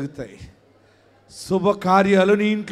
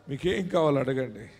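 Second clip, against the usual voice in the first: ordinary talk, nothing marked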